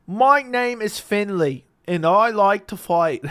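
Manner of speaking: loud, slow put-on Irish accent; Conor McGregor accent. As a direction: belt out a stretched mock Irish accent. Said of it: "Pretty solid accent".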